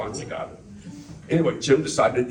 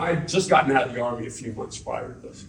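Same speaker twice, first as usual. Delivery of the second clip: normal talking voice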